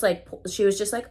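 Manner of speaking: ordinary talk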